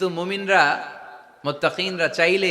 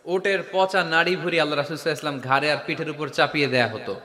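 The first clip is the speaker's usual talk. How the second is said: ordinary delivery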